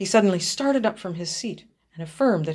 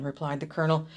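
ordinary delivery

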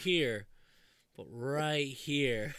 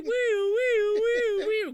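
high, loud woo-woo wail; siren imitation; 7 dB louder, much slower than usual. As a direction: copy an ambulance siren with your voice.

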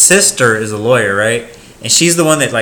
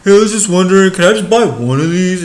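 high, loud, wheedling customer voice; whiny customer; 8 semitones higher, 5 dB louder than usual. Retold or imitated. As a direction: wheedle like the annoying shopper. Mimics annoying customer.

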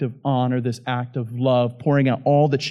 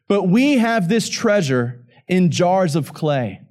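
higher, emphatic pulpit reading; Scripture reading aloud; 6 semitones higher than usual. Acted. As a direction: declare the verse to the congregation.

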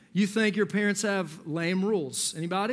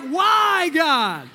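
high, loud mock lament to heaven; playfully anguished appeal to the sky; exasperated plea to God; 4 semitones higher, 4 dB louder, slower than usual. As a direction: cry out "why" dramatically, then ease down. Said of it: "look up to the sky and you're like".

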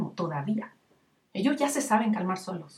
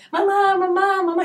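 put-on much higher, louder nagging child; whining toddler; 9 semitones higher, 6 dB louder than usual. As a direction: beg like a two-year-old wanting cereal.

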